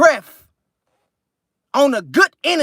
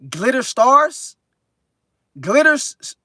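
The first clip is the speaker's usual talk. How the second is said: slow, rising, puzzled take on "Glitter Stars"; puzzled reading; 3 semitones higher, slower than usual. Real, not acted. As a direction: sound out "Glitter Stars" slowly with a question rise. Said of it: "Glitter Stars in this thing".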